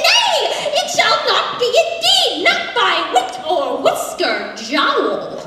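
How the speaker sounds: high, loud theatrical refusal; defiant pig. Acted. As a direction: cry NAY up high, then proclaim the refusal.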